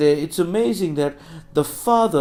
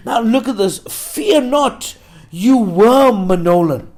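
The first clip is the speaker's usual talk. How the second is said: high, loud, declamatory pulpit voice; full-force preaching; 8 semitones higher, 8 dB louder than usual. Real, not acted. Preacher urges named listeners not to fear, full-force preaching. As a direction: proclaim it insistently with raised pitch and volume.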